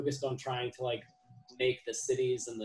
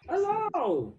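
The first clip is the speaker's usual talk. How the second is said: excited hello; 4 dB louder than usual. Real, not acted. Greeting friend joining call.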